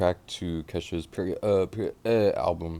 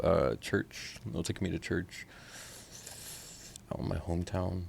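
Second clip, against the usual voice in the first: ordinary talk, nothing marked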